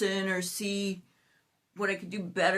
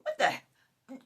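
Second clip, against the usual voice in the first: ordinary talk, nothing marked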